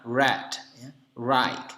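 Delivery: "red" and "right" with rounded untrilled r; American w-like R. Shown as a demonstration. Teacher giving American accent examples, w-like R. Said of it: "seolah-olah bunyi W-nya".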